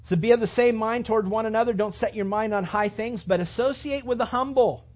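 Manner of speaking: loud, high preaching with Paul's words hammered home; fiery preaching. Acted. Preacher urging the church to bless, preached at full force.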